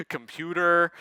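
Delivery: normal talking voice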